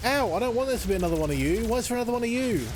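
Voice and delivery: rattled and pleading, words tumbling out; panicked dismay. Real, not acted. Gamer panicking at yet another enemy, high and fast.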